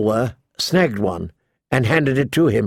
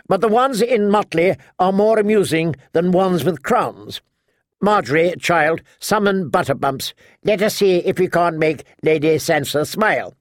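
higher-pitched, sharp-tongued matriarch; imperious old lady; 5 semitones higher than usual. Acted. Lady Alina ordering her company about, imperious old lady. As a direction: hold forth as a bossy noblewoman.

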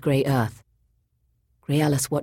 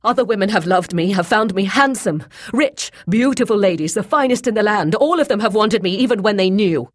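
loud, raised, fast boasting; bitter; 7 semitones higher, 6 dB louder, faster than usual. A narrator's reading. Rejected Boyce listing women who loved him, bitter. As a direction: pour out wounded pride, bitterly. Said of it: "he said bitterly".

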